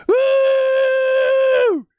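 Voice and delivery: very high, loud whoop; excited whoop. Real, not acted. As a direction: let loose a joyful scream. Hyped about free agents.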